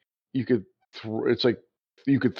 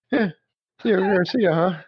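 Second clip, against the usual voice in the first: forced cheer; 10 semitones higher than usual. Retold or imitated. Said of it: "trying to talk himself into it being okay".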